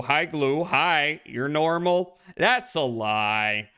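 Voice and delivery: usual speaking voice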